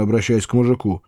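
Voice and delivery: usual speaking voice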